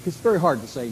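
normal talking voice

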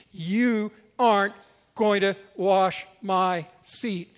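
slow, weighted, word-by-word refusal; acted stubborn disciple laying down the law; flat refusal. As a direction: space out every word to forbid it. Peter's final refusal, acted.

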